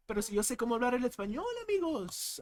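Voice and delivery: fast, exaggerated Spanish accent; mock Spanish accent, rushed; enriched Spanish accent. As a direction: rattle off the Spanish like a show-off. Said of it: "enriched Spanish accent".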